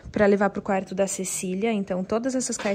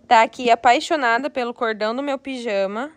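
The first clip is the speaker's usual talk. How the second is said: ordinary talk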